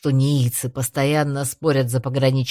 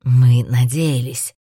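embarrassed; 3 semitones lower, 7 dB louder, slower than usual. A narrator's reading. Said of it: "смущённо пояснил он".

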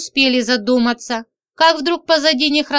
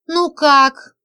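trembling voice; 4 semitones higher, 3 dB louder than usual. A narrator's reading. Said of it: "дрожащим голосом спросила она".

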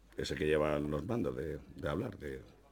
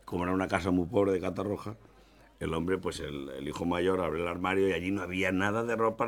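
ordinary delivery

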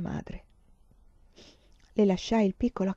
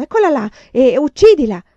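very loud, raised-pitch hunting shout; urla degli inseguitori; 5 semitones higher, 11 dB louder than usual. A narrator's reading. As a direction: yell it as men spotting their prey. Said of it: "Gli uomini con le lanterne urlarono". (grido forte da inseguitori)

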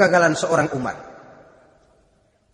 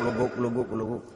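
boiling sound; 8 semitones lower, 11 dB quieter than usual. Retold or imitated. Imitating boiling water sound.

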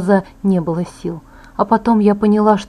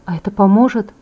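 usual speaking voice

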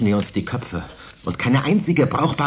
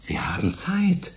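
slow conspiratorial whisper; boy whispering the plan's last point to friends; 8 semitones higher than usual. Acted. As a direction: whisper it like a shared secret. Pitching secret plan.

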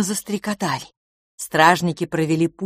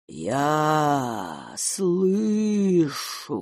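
slow eerie whisper; much slower than usual. Acted. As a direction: stretch it like fresh resin. King's first slow eerie words. (медленный зловещий шёпот)

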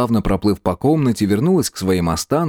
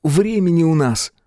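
higher-pitched, urgent plea; anxious entreaty, pitched up; pleading; 8 semitones higher than usual. A narrator's reading. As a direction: plead as time runs out. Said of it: "взмолился он".

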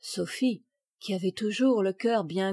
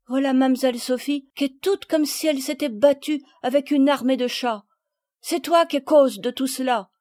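loud, higher-pitched reproach; admonishing country mother; 4 semitones higher, 6 dB louder than usual. A narrator's reading. Mother lectures repentant Palmyre, emphatic reproach. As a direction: drive the moral home to the child.

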